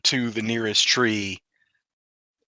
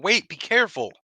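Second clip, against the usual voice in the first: mild acted yell; 8 semitones higher than usual. Carrick warning a climbing companion, a mild pitched-up yell. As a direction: call out a warning, half-voiced. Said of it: "Carrick is gonna yell out".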